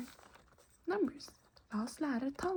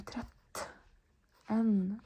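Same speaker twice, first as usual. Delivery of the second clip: Tretten with a small stop between the two t's; Tretten, catch briefly on the double t; little pause; 4 dB louder than usual. Shown as a demonstration. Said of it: "kind of almost stop there".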